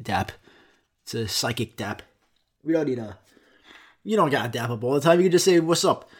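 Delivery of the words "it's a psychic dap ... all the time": normal talking voice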